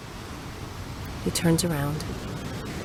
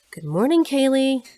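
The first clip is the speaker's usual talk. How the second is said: high, raised voice rising at the end; pointed loud greeting; 8 semitones higher, 4 dB louder than usual. Acted. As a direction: call to someone wearing earphones. Pointed greeting to headphoned daughter.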